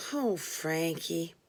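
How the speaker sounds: dramatic sigh. Retold or imitated. Narrator sighing at the confessed sexual deviant, soft and slow. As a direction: sigh his name out theatrically. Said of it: "I sighed dramatically".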